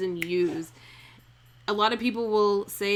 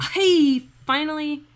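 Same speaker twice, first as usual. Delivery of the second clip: excited; 8 semitones higher, 9 dB louder than usual. Real, not acted. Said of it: "we have ten syllables".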